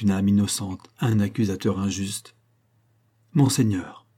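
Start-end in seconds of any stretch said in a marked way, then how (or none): 3.3-4.1 cold. A narrator's reading. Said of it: "un accent dont la froideur".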